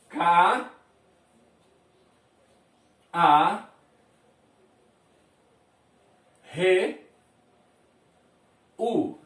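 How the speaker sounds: "Ca-", "a", "re", "u" spaced apart, each sound alone; sounds spelled out. Shown as a demonstration. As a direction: break the word into separate sounds with long pauses. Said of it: "A gente fala K A R U".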